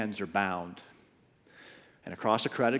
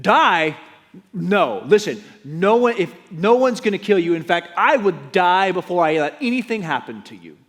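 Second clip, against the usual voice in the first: higher, louder, urgent denial; alarmed protest; 7 semitones higher, 10 dB louder than usual. Acted. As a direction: cry out that no one will hurt him. Peter protests loudly to Jesus.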